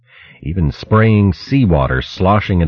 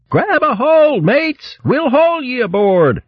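much higher, calling out to mates in the water; hearty high sea-mouse hail; high hearty sailor mouse; slower than usual. Acted. Mouse sailor calling to his mates overboard, high and hearty.

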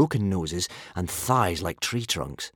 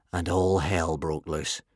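usual speaking voice

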